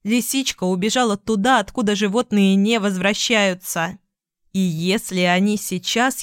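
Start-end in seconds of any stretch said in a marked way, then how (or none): none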